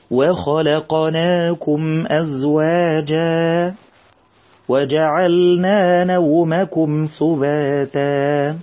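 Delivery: very slow chanted recitation, doubled meem held; Quran recitation. Shown as a demonstration. Teacher models the verse for children, slow chanted recitation. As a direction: chant it slowly as in tajweed class.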